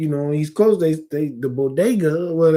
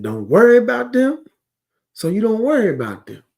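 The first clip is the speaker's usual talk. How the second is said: a higher, louder voice of divine command; voicing God; 7 semitones higher, 5 dB louder than usual. Preacher voicing God to the congregation, raised commanding tone. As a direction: speak for God, lifting the pitch.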